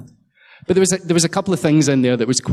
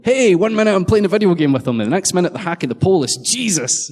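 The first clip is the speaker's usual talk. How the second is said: higher, louder, amazed gamer voice; mock Dan tweet; 7 semitones higher, 3 dB louder than usual. Reading Dan's tweet about his hacker friends, amazed. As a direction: sell the disbelief of a gamer.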